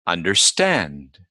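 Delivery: understand with STAND stressed; understand, weight on the final syllable; stress on second part. Shown as a demonstration. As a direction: slowly, rise onto STAND in understand, then fall. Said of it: "always the second word".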